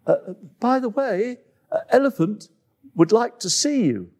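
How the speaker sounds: high, halting, a bit awkward; high nervous animal voice; hesitant antelope voice. Acted.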